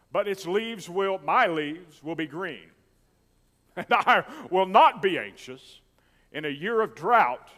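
loud, slow, rising preacher's declaration; full-force pulpit preaching, climbing to the end. Acted. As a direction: build it up and proclaim it to the church.

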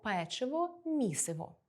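usual speaking voice

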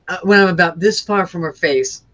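ordinary delivery

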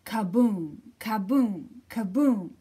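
loud, falling boom; booming kaboom. A narrator's reading. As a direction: boom it out like a rolling boulder. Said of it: "Big Rock answered".

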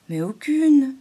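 perplexed. A narrator's reading. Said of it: "répondit Zigzag un peu perplexe".

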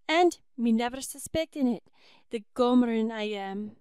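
loud, lamenting Irish wail. Acted. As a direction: cry out in self-reproach, Irish lilt. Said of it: "wailed Judy".

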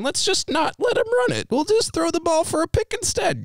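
high, mocking voice of the clueless coaches. Friend mocks the coaches' Super Bowl call, high mocking voice. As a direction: sneer out the bad play call in a high voice.

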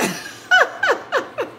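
real laugh, pitched very high; a bright burst of laughter up high. Real, not acted. Cook laughing as the chicken falls apart, high laugh.

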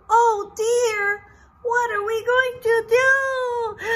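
high, loud fretting; worried workers. Acted. Teacher voicing the fretting workers, high and loud. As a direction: panic about the problem for the kids. Characters' problem acted out.

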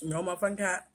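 usual speaking voice

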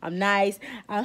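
ordinary talk, nothing marked

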